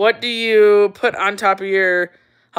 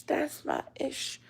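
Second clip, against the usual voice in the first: whisper; 5 semitones higher, 16 dB quieter than usual. Real, not acted. Repeating catchphrase, dropping to whisper.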